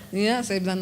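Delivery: ordinary talk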